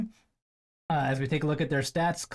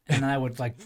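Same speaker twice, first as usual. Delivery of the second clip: ordinary delivery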